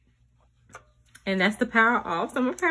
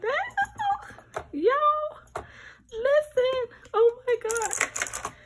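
much higher, thrilled squeal; delighted; 13 semitones higher, much slower than usual. Real, not acted. Seeing the keyboard light up, high thrilled squeal. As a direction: gasp with delight.

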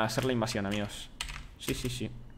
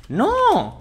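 much higher, louder cry of no; sharp yell; 10 dB louder than usual. Real, not acted. Streamer shrieks as a villager escapes. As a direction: shriek a sudden no. Frustrated outburst.

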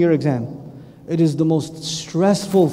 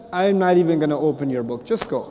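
low, soft, kind release; tender mercy; 6 semitones lower, 4 dB quieter than usual. Retold or imitated. Allah waves the beloved through: low, soft, kind. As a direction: let them go gently.

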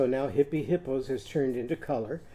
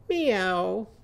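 higher, falling cat cry; cat meow; 5 semitones higher than usual. Shown as a demonstration. Teacher showing what the cat is saying, cat meow. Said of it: "So what is our cat saying?".

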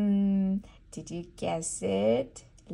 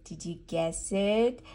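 ordinary talk, nothing marked

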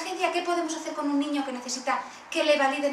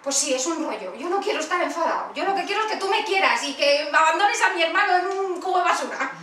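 put-on sulky child; 3 semitones higher, 5 dB louder than usual. Child role-play with mother.

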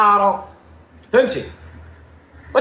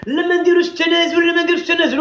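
arrogant refusal; 6 semitones higher than usual. Retold or imitated. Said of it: "بقيتو مفرعنين".

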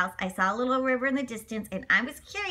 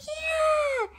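cheering yeah. Acted. George delighted by his boat plan, cheering. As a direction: cheer the idea.

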